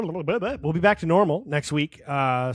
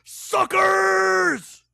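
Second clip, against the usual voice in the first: high shouted Suckers, louder; Scorpion voice; 6 dB louder than usual. Retold or imitated. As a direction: repeat the cheesy ninja yell. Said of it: "in this Scorpion voice".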